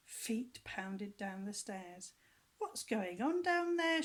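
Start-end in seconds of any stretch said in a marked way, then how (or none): 2.6-4.0 high, loud alarmed dad; startled father shouting. A narrator's reading. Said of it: "shouted Dad".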